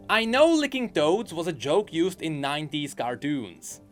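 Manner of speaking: ordinary talk, nothing marked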